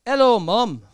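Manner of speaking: loud, mock-posh [name] greeting. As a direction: call out like a chirpy pop star. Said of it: "That's how he speaks".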